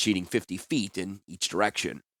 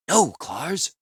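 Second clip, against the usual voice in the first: gentle plea; 7 semitones higher than usual. Acted. Healer restraining angry companion.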